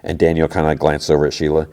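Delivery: plain reading voice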